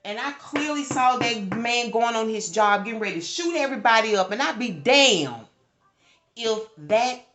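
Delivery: loud, rising, agitated retelling; heated storytelling at full volume; worked up. Real, not acted. Reliving a foreseen murder.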